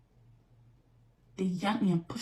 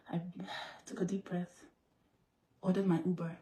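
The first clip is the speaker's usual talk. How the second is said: much softer, drained; subdued; 3 semitones lower, 9 dB quieter than usual. Real, not acted. Quietly recalling crying alone at home, drained and soft. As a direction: let the energy drain out.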